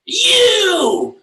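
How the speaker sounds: high loud barked "You!"; imitated shout. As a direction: shout it like a bouncer. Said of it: "the guy at the supermarket".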